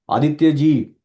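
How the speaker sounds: calling Aditya loudly across the room. Shown as a demonstration. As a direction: call the name out loud. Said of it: "बड़ी आवाज में बोलूँ".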